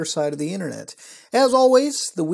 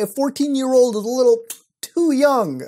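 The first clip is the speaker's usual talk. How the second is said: ordinary talk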